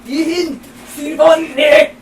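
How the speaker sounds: slow loud fake German; mock German accent. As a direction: drag out hammy German.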